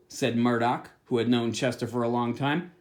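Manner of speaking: ordinary delivery, nothing marked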